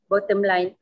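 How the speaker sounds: normal talking voice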